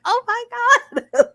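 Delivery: high, loud yell of disbelief, half laughing; retold yelling at the TV. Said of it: "yelling at the".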